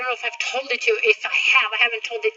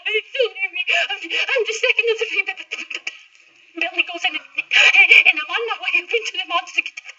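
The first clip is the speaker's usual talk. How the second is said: stammering nervous goat; 6 semitones higher, faster than usual. Acted. Second goat stammers to troll.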